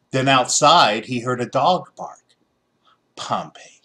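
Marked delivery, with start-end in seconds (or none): 3.2-3.8 soft whispered name; whispered thought. A narrator's reading. Boy names the dog in his head, whispering. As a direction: breathe the name as a private thought. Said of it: "he thought".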